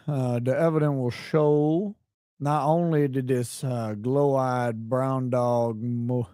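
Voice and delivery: lightly put-on Southern lawyer drawl, slow; a mild South Carolina courtroom voice; South Carolina accent. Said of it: "in South Carolina accent".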